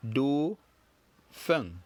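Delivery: Dauphin drawn out with a nasal final feng; teacher modelling Dauphin; slow French model. Shown as a demonstration. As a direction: teach Dauphin slowly for repetition.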